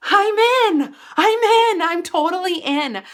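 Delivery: high, loud excited chant; thrilled inner cheer. Retold or imitated. Girl sees her friend's car and cheers inside, high and loud. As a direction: cheer it to yourself, bursting with excitement.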